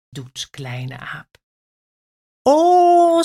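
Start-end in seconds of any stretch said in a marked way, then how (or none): 2.4-3.2 much higher, louder surprised oh. A narrator's reading. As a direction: gasp of delight. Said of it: "zegt de olifant verrast". (olifant zegt verrast oh)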